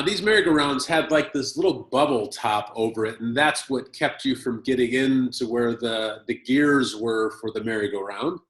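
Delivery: ordinary talk, nothing marked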